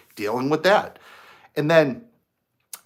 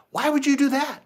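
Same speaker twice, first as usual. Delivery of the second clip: friend surprised; 13 semitones higher than usual. Retold or imitated. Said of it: "he goes".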